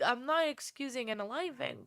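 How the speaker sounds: ordinary delivery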